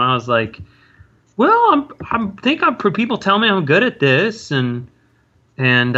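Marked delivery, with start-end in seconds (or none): 1.4-4.5 high, puffed-up mock confidence; cocky teen self. Telling his high school girlfriend, mock cocky and high. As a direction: play your younger self full of himself.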